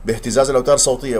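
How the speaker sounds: ordinary talk, nothing marked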